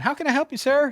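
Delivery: high, bright front-desk greeting; greeting the bailiff at the front desk, cheerfully high; chipper service voice. Retold or imitated. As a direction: sing it out like a receptionist.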